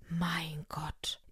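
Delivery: hushed, whispered exclamation; shocked whisper. A narrator's reading. Friend reacts to the murder news, stunned whisper.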